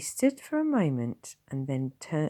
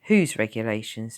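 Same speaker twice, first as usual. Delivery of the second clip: challenging; 8 dB louder than usual. Acted. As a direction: throw the question back at her. Tom challenges nurse.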